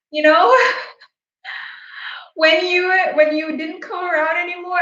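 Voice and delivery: holding back sudden tears; real tears catching the words; starting to cry. Real, not acted. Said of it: "I, like, started crying".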